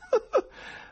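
high, breathy madman's laugh; mad burst of laughter. Acted. Professor bursting into laughter at the writers, high wild cackle. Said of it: "scoppiò in una risata tale".

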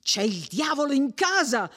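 high, urgent breathless alarm; panting, frightened boy. A narrator's reading. Menico, out of breath, warns of danger at home, panting fright. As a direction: blurt it out high and scared. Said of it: "riprese Menico ansante".

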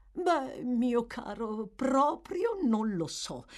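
amused. Acted. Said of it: "disse la governante divertita".

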